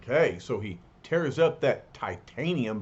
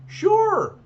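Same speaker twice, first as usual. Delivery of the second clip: a sudden high, louder squeak of "sure"; nervous yelp; 6 dB louder than usual. Acted. Fake Thing caught lying to Reed, squeaking in alarm. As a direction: blurt it out high, caught off guard.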